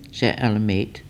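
usual speaking voice

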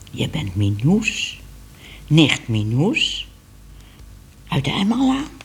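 put-on stately old cat realising who stands there; old cat Moortje. A narrator's reading. Old cat recognises Minoes.

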